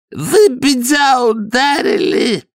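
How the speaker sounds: high, slow shriek; nasal shriek through broken nose. A narrator's reading. Downey shrieking through his smashed nose. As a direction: squeal it through a bloodied nose. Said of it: "завизжал Дауни".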